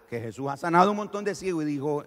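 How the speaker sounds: ordinary talk, nothing marked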